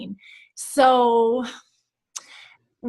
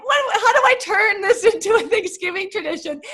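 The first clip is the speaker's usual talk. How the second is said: laughing panic; 10 semitones higher than usual. Retold or imitated. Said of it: "We're like".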